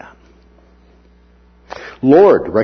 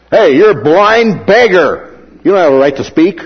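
louder, higher scolding of the beggar; stern rebuke; 6 semitones higher, 7 dB louder than usual. Retold or imitated. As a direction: bark him down like the crowd. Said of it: "sternly telling him to be quiet".

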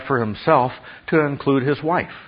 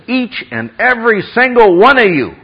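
raised, loud stress building through EVERY SINGLE ONE OF YOU; emphatic; 10 semitones higher, 7 dB louder than usual. Acted. Preacher quotes Paul addressing every husband, loudly emphatic. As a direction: pound out each word, high and forceful. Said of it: "He's emphatic about it".

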